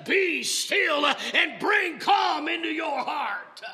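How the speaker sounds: loud, high, rhythmic preaching; impassioned sermon climax; preaching at full force. Acted. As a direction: drive it home to the congregation.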